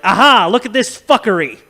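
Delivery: loud gotcha exclamation; mock triumphant discovery; triumphant aha. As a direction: pounce on it loudly. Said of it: "he's gonna be like".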